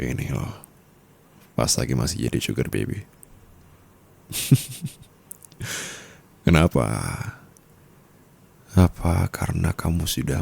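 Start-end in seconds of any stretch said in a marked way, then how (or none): 4.3-4.9 a light airy laugh under the breath; teasing breathy chuckle; breathy giggle. Acted. Teasing partner.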